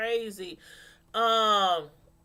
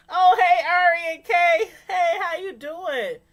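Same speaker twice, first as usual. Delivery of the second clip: loud, high, happy hello; loud greeting; 7 semitones higher, 9 dB louder than usual. Real, not acted. Greeting viewers Ari and Kay in the chat. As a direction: call out the names with delight.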